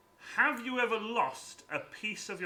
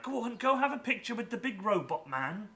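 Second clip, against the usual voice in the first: lightly voiced dad, higher pitch; higher-pitched dad urging his child along; 6 semitones higher, 4 dB louder than usual. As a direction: egg a kid on like a keen dad. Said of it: "like, a dad being like".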